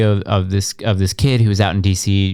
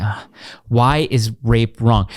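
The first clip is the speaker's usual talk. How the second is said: slow exasperated question; slower than usual. Retold or imitated. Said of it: "And I was like".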